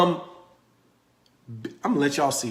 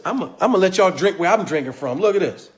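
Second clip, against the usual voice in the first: normal talking voice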